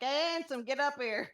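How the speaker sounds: flirty call. Acted. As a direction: tease him up with a flirty shout. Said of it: "Roger is just gonna be like".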